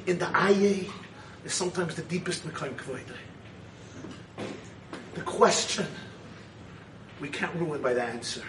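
low, soft, subdued voice; hushed reflective preaching. Real, not acted. Rabbi reflects on questions without answers, low and hushed. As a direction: let it sink into quiet contemplation.

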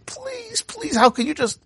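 high wailing plea; sobbing plea. A narrator's reading. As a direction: beg through sobs, heartbroken. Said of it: "the guy breaks down completely, sobbing".

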